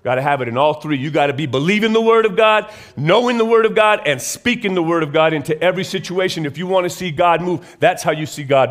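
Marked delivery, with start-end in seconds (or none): 1.0-5.3 loud, high preaching push; preaching at full force. Real, not acted. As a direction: drive the point home loudly. Preaching point building.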